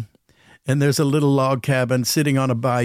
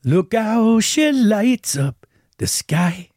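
slow, higher, hushed awe; recalling the song about the evening star; a touch of wonder; 7 semitones higher, much slower than usual. Retold or imitated. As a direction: gaze up and marvel. Quoting song about Evangeline.

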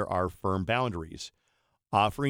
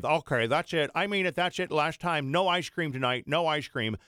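higher-pitched mock mom threats; imitated scolding mom; 7 semitones higher than usual. Mom threatening her boys all afternoon, high mock scolding. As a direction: mimic a mom piling up empty threats. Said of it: "she would say".

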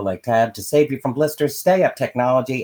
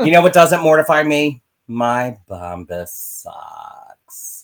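ad segue; 5 semitones higher, 7 dB louder, much slower than usual. Acted.

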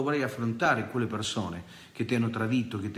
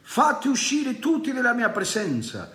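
high, raised voice, crying out; Joseph's cry; 11 semitones higher, 4 dB louder than usual. Acted. Joseph ordering everyone out of the room, crying out. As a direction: call it out like a man who can't hold back. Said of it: "e gridò".

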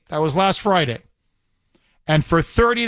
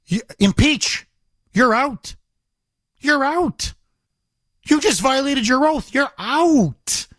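retold yell; 5 semitones higher than usual. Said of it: "I would have just yelled out".

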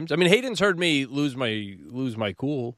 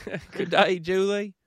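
higher-pitched, playful mock Aussie; put-on Australian accent; 7 semitones higher than usual. Husband echoing wife's greeting in fun, mock Australian accent. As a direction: throw on a jokey Australian accent. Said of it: "bursts into these accents".

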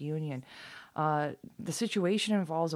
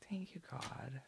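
"Thank you, God." whispered, softer; 13 dB quieter than usual. Real, not acted.

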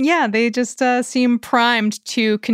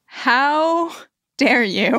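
mock outrage; 2 semitones higher, much slower than usual. Mock offense at friend.

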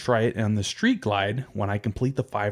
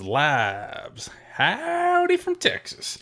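slow, high mock Texan drawl on howdy; Texas drawl; 11 semitones higher, much slower than usual. Reading a listener email aloud in a mock Texas drawl. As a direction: ham up a Texas cowboy howdy. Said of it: "similar to your Irish accents".

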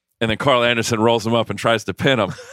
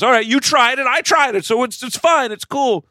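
high, louder breezy wrestler voice; hammed-up brushing it off; mock casual reassurance; 5 dB louder than usual. AJ telling his partner it's fine, high and breezy.

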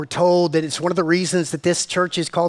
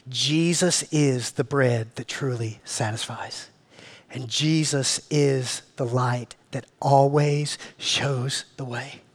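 slow, soft, lower closing words; tender declaration; 3 semitones lower, 4 dB quieter, slower than usual. Real, not acted. Pastor closing his sermon to the congregation, slow and tender. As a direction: lay it down gently for the room.

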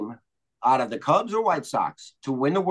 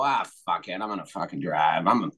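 mock a rich drunk shrugging it off; dismissive drunk mock voice; 8 semitones lower than usual. Imitating a rich drunk deciding to drive himself, low and careless. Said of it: "and you go".

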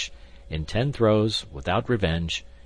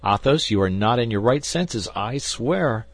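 higher, louder exasperated outburst; indignant protest; 5 semitones higher, 6 dB louder than usual. A narrator's reading. D'Artagnan rebuking his friend over the lost horse, indignant.

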